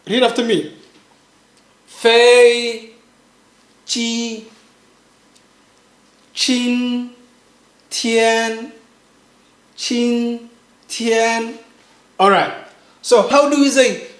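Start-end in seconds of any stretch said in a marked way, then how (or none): 1.8-11.6 QING, TIAN, FEI, JI each drawn out level; first tone. Shown as a demonstration. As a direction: separate syllables qing, tian, fei, ji on one flat pitch. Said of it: "make sure it's flat".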